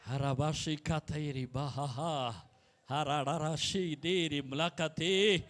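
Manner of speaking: soft, slow, low murmured tongues; speaking in tongues. Acted. Pastor praying in tongues before the church, hushed and slow. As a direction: let the syllables flow slowly under the breath.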